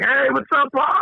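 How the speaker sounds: high, bright crowd hello; cheery bar greeting. Retold or imitated. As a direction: greet the regular like an old friend. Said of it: "everybody in the bar is like".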